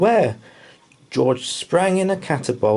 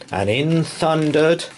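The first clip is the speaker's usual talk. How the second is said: ordinary delivery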